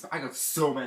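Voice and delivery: usual speaking voice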